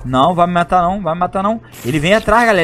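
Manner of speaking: ordinary delivery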